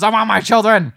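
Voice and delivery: high, very loud demand; hammed-up shouted demand; mock furious yell. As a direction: bellow it as the desperate mother. Acting the mother attacking with poker.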